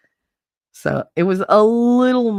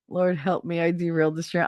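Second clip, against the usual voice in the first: ordinary talk, nothing marked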